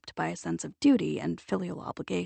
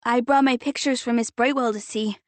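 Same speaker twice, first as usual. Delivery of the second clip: put-on proud child; 4 semitones higher, 6 dB louder than usual. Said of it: "Carson said proudly".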